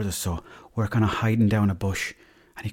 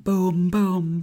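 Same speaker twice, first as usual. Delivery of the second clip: punch sounds; 9 semitones higher than usual. Retold or imitated. Punches landing in fight.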